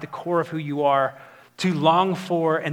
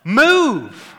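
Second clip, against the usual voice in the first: louder, higher blunt command; firm command; 5 semitones higher, 7 dB louder than usual. Retold or imitated. Pastor voicing God ordering Moses to move, louder and firm. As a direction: bark it out as God's order. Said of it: "and God says".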